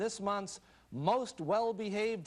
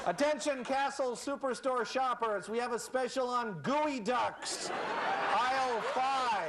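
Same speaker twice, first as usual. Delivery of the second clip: mock store PA announcement, higher; sing-song shopper announcement over a loudspeaker; store announcer; 6 semitones higher than usual. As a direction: supermarket intercom voice.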